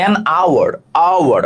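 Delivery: "hour" said as "our", opening on an a sound; teacher's model of "hour" starting on the vowel; silent H. Shown as a demonstration. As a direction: link "an hour" with no h at all. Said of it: "উচ্চারণটা আ হয়েছে".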